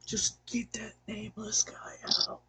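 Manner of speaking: conspiratorial whisper; whispered aside. Acted. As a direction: hiss it out of earshot. Aside to departing player.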